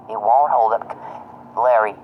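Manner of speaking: ordinary delivery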